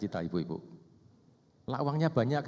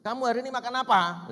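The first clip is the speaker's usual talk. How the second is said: much higher, louder, impatient question; irritated repeat; 12 semitones higher, 6 dB louder than usual. Retold or imitated. Pastor re-asking his silent wife, irritated. Asking again, losing patience.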